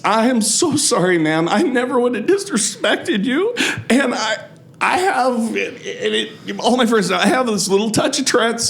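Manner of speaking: high, overly sweet apologetic voice; fake sincere apology. Retold mock sincere stage apology.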